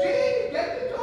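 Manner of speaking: high, nagging call; impatient call. Acted. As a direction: call out sharply to a husband. Wife calls out impatiently again.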